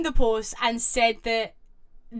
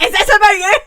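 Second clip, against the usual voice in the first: higher, louder mock outburst; imitating mum; 8 semitones higher, 11 dB louder, faster than usual. Retelling the mum's blurted question, loud. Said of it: "be like".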